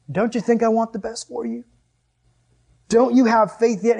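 Jesus scolding. Acted. Jesus to doubting disciples, mild exasperated scolding. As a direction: ask it as an exasperated appeal. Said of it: "So Jesus kinda scolds them".

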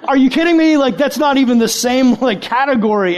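mock amazement at a friend's huge drive; incredulous. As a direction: toss it up in amazed disbelief. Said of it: "And you're like".

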